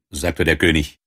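ordinary delivery

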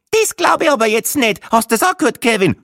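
very high, loud Viennese outrage; indignant wife. A narrator's reading. Wife appeals to Kevin about the apology, indignant and high. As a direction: squawk it in offended disbelief.

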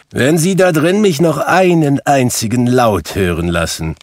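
loud, high, scolding warning; irate employer raising his voice; angry threat. A narrator's reading. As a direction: threaten the clerk sharply. Scrooge threatens the applauding clerk.

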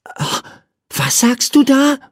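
put-on curious little boy. A narrator's reading. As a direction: ask it like an inquisitive small boy. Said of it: "fragte ihn Michael neugierig".

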